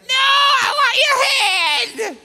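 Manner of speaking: very loud, high, stretched wail; crying toddler tantrum. Retold or imitated. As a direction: scream a demand like a toddler mid-meltdown. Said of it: "He's gonna cry either way".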